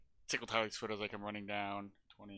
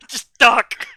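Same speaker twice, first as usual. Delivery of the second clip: loud, raised bark of alarm; in-character warning shout; 5 semitones higher, 11 dB louder than usual. Acted. Player in character telling Tally to duck, urgent shout. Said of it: "That's in character".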